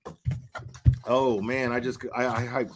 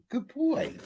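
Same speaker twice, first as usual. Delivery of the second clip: much higher sing-song praise for the dog; 13 semitones higher, 4 dB quieter than usual. Real, not acted. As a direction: praise him in a high pet voice.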